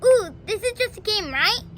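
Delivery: fast, loud, eager question; quick innocent excitement; eager kid. Retold or imitated. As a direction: bounce with childish excitement. Little Petey eager, innocent.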